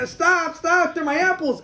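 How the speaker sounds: high mock yell; screaming stop. Peddler yells at crowd grabbing apples, high mock yell. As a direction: cry out to stop them. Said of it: "this guy's screaming".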